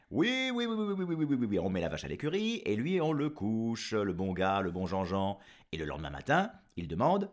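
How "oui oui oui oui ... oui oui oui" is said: fermière accueillante. Acted.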